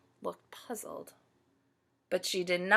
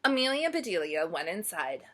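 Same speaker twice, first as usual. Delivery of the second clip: ordinary delivery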